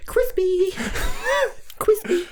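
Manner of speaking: high-pitched silly squeak; squeaky high voice. Pretend. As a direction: squeal the word playfully, way up high. Silly repeated word, squeaky.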